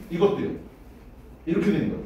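ordinary talk

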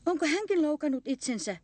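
high-pitched, mildly alarmed question; startled. A narrator's reading. Young one fears the mother is hurt, startled high question. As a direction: ask high, with a touch of fright. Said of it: "säikähti kulti".

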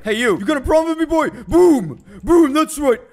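high, loud bully challenge; hammed-up brute picking a fight; tough-guy taunt. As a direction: swagger up to the enemy. Taunting enemy as monster.

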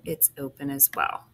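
normal talking voice

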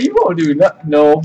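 normal talking voice

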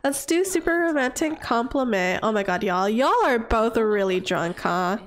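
normal talking voice

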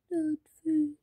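a mock sad face in the voice. Shown as a demonstration. Girl unboxing toys shows a sad face, mock sad. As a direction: droop the words like a sulky pout.

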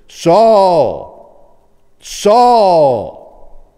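booming, drawn-out call from heaven; heavenly voice. Acted. Voice from heaven calling Saul, booming and drawn out. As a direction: call the name out loud and long, falling. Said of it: "He heard a voice say to him".

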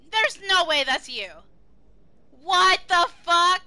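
loud, high disbelieving shouts; shocked yelling. Real, not acted. Streamer stunned by a viewer's huge following, shouting. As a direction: yell in real disbelief.

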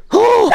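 very high startled shriek into laughter; high squeal dissolving into a laugh; shriek then laugh. Real, not acted.

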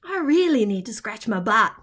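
low, fast, soft grumble; an itchy wombat grumbling under his breath; grumpy wombat mutter. Acted. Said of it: "he said".

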